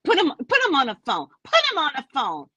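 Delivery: acted impatient order; insistent demand. Acted demand to the child's mother.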